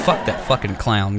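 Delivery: ordinary talk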